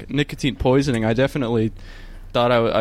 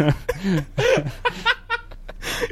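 high giggle; 5 dB quieter than usual. Real, not acted. Laughing at own joke.

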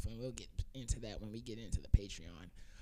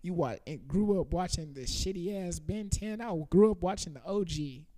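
ordinary delivery

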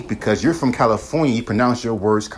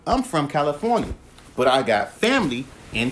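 ordinary talk, nothing marked

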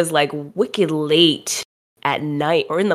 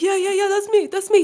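high, eager reply; husband answering the official, high and eager. Retold or imitated. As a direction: jump in quickly like the husband. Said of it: "Warren's like".

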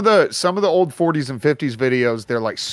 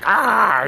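startled yelp; 8 semitones higher, 5 dB louder than usual. Retold or imitated. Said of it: "and he's like".

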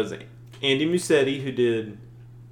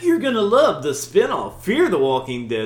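salesy enthusiasm; 6 semitones higher, 6 dB louder than usual. Retold or imitated. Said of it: "It's like".

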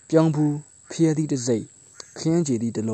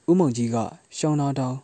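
usual speaking voice

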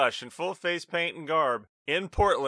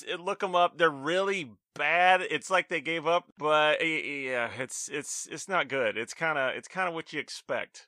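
normal talking voice

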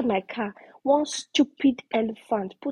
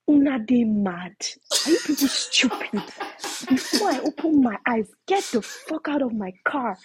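indignant retold yell at intruders; irritated screaming, retold; 4 semitones higher than usual. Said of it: "I got so irritated and I started screaming".

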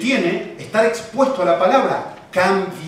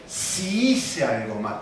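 long drawn-out SI, softer voice; slow, doubting SI; distancing stress on SI; 6 semitones lower, 5 dB quieter, much slower than usual. Shown as a demonstration. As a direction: stretch SI to hold the blame at a distance. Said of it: "como que te, que está muy lejos de mí".

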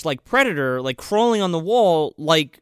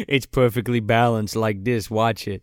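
low villain voice; 7 semitones lower than usual. Retold or imitated. Thanos quote voiced low.

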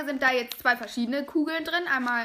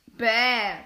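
disgusted groan; 7 dB louder than usual. Real, not acted. Tasting a disgusting snack.

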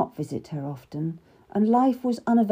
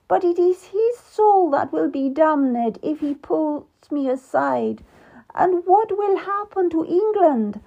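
weeping; 10 semitones higher, 3 dB louder than usual. Acted. Abandoned queen alone, weeping for her husband. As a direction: grieve through tears for the lost husband. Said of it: "leaving Queen Catherine weeping".

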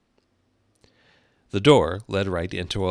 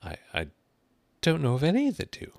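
put-on polite, high girl's voice; 9 semitones higher, faster than usual. A narrator's reading. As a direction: stammer gently, then lift the pitch. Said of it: "Alice said very politely".

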